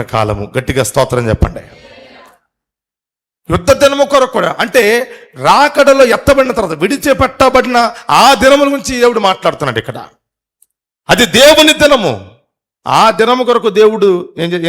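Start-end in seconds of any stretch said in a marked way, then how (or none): 3.5-14.7 high, loud preaching, rising to a climax; preaching at full force. Acted. Preacher exhorts church not to skip services, loud and high. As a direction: hammer the point home to the congregation, loud and high. Preacher proclaims the day of God.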